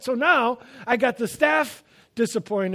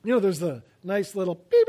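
ordinary talk, nothing marked

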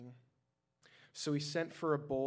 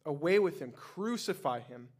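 loud, higher crowd yell, slowing on crucify; 3 semitones higher, 8 dB louder than usual. A narrator's reading. Crowd to Pilate, a loud yelling demand to crucify. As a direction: roar the demand. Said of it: "they yelled".

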